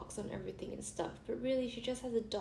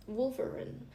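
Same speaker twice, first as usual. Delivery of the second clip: ordinary talk, nothing marked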